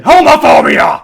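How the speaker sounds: loud, high mock accusation; mock outraged shout. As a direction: yell it like a scandalised accuser.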